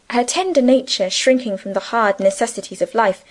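ordinary delivery, nothing marked

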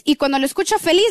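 ordinary talk, nothing marked

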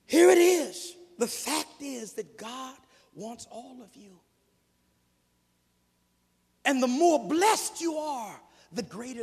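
loud, rising preacher's cadence; full-force preaching. Real, not acted. Preacher pressing the point on the congregation, full force. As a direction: drive it home from the pulpit.

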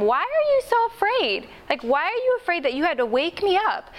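high, puzzled voice of a baffled Jesus; why on earth were you scared?; incredulous Jesus. Retold or imitated. Jesus asks his frightened disciples why they woke him, incredulous. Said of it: "And he said to them".

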